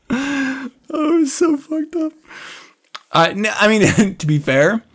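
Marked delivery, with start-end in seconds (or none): 0.1-2.1 high, soft put-on listener voice; lightly voiced listener, answering back; mock listener reply. Playing a listener asked about their Saturday, high soft mock reply.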